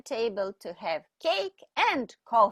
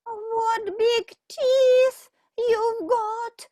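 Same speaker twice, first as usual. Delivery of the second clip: much higher, louder and slow; frightened girl; 11 semitones higher, 6 dB louder, slower than usual. Acted. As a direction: stretch it out in mounting fear. Girl voiced.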